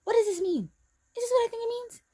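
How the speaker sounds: high-pitched retold questions; squeaky-high acted-out questioning; asking friend anxiously. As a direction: ask it high, imitating your earlier self. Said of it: "I said to her".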